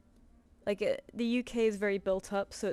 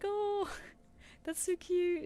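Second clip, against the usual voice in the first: gushing squeal; 10 semitones higher, slower than usual. Retold or imitated. Retold own gushing reaction.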